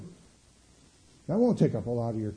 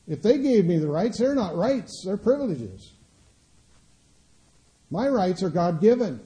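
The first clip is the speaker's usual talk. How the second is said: louder, higher, pounding each claim about rights; preaching at full force; 7 semitones higher, 5 dB louder than usual. Acted. Preacher rousing the congregation about God-given rights, defiant and louder.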